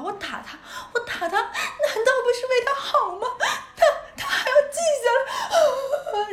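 high, loud, drawn-out imitation of sobbing; mock wailing. Mum crying to dad about her daughter, mock wailing. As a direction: wail it slowly through laughter, hamming up the hurt. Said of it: "然后开始狂哭".

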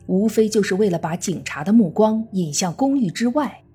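normal talking voice

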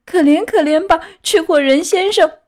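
put-on high, loud begging puppet boy; pleading. A narrator's reading. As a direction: beg for mercy in a high-pitched wail. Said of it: "开始哀求他说". (尖着嗓子哀求)

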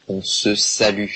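slow, careful model of the phrase; slow teaching model. Shown as a demonstration. Teacher modelling the phrase slowly for students. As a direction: say it slowly so the class can copy. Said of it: "je vous l'écris ici".

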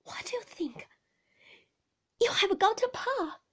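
high, slightly breathless announcement. A narrator's reading. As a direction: break the news as if short of breath. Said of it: "speaking in a breathless sort of way".

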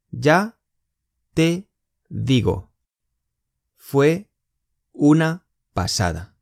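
slow, each word split apart. Shown as a demonstration. Teacher to students, every word said apart. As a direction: break the phrase into single words. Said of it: "teacher splits phrase word by word".

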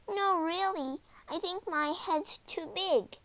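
put-on high, innocent child's voice; protesting little girl. A narrator's reading. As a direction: protest sweetly, pitched high. Gretel feigns helplessness to the witch.

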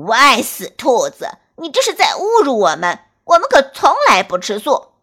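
loud, fast furious wolf yelling; insulted wolf roaring back; angry shout. Acted. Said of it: "一头黑狼生气地大叫着".

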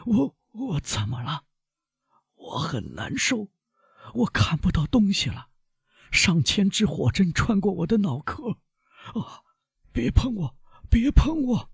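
high, thin, strained voice of pain; stricken, very high-pitched pleading; agonised panic. A narrator's reading. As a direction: gasp it out as a fit takes hold, softly rising to a cry. Dying servant in agony and terror.

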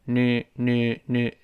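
"nu" slowly, tongue tip just behind the upper teeth; clear n sound. Shown as a demonstration. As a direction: drill "nu" with a clear n, not l. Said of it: "注意舌尖沿着上牙根儿往后滑一丁点儿".

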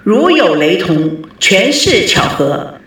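slow deliberate disclaimer read; announcer outro. A narrator's reading. Host reading the closing disclaimer to listeners, slow announcer voice. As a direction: read the disclaimer slowly and clearly.

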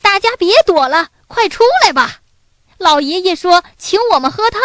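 put-on high, loud, rapid child's call; loud boy's shout. A narrator's reading. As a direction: call your hidden friends out excitedly. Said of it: "大声叫道". (小男孩高声快速地大喊)

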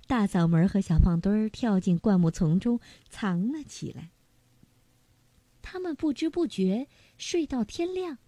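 ordinary delivery

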